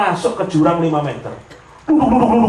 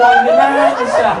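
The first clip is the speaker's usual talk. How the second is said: very high wailing mock sob; mock crying; 4 dB louder than usual. Boss pretending to cry, very high mock wail.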